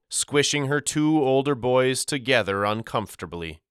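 plain reading voice

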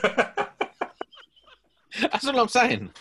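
slow words through laughter; laughing. Real, not acted. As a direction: drag it out while cracking up. Laughing at dad joke.

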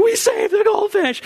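put-on high, thrilled kids' shout; excited cheer. Kids cheering that they saved the fish, high and delighted. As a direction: celebrate the rescue.

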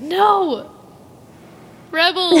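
high, loud dramatic refusal; hammed-up wail. Fan retelling refusal to calm down over Rebels, hammed-up wail. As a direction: cry out in mock anguish.

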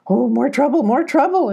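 high, fussing goat voice; fretting goat. Acted. Goat answering its friend, high and fretful. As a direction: fret in a high voice, climbing on the repeat. Goat's friend frets about trouble.

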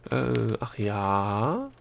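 very drawn-out, hesitant question; stalling exclamation. A narrator's reading. Gnome taking Delia's cue to stall, hesitantly drawn out. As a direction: stretch it slowly into a rising question. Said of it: "rief der Gnom".

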